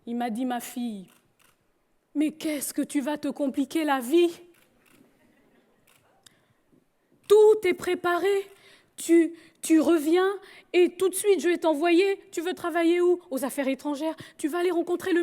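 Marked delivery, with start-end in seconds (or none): 0.5-4.4 pleading father. Retold or imitated. Father urges daughter to return. 7.3-13.2 insistent father. Retold or imitated.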